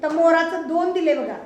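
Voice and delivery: ordinary talk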